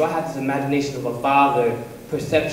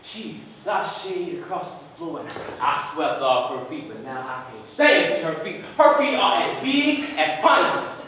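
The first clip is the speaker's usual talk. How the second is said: storytelling that jumps up into exasperation; stage comedy with a rising pitch; comic monologue; 4 semitones higher than usual. Acted.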